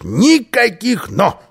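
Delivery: loud, high, drawn-out interruption; резкий обрыв. A narrator's reading. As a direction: cut him off sharply and loudly. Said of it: "резко оборвал его Карлсон". (резко и громко оборвать)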